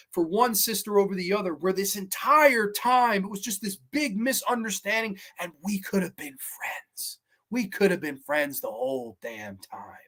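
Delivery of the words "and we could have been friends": soft echo. Retold or imitated. Echoing the quoted line softly.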